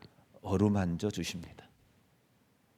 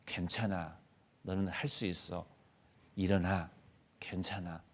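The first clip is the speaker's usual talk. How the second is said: softer, tender reassurance; gentle comfort; 4 semitones lower, 10 dB quieter than usual. Pretend. Parent comforting a tired child, soft tender reassurance. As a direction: soothe a struggling child softly. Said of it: "이렇게 어루만져 주잖아요".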